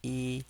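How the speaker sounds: yi held high and level; first tone. Shown as a demonstration. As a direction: keep "Yi" flat on a high note. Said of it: "These are the four tones".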